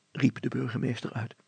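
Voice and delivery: ordinary delivery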